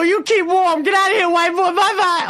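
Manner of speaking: very high mock crowd voice; high, teasing farewell holler; jeering send-off. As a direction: imitate a crowd hooting someone out. Crowd jeers him out the door.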